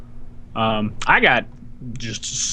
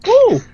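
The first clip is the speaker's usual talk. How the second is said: high, loud excited "ooh"; 7 dB louder than usual. Real, not acted. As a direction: let out an eager, high-pitched ooh. Delighted to be asked about game.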